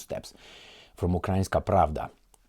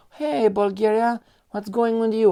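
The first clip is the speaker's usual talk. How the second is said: teasing call-out; 13 semitones higher, 6 dB louder than usual. Pretend. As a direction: call to Bulgaria like an old friend. Calling out to Bulgaria.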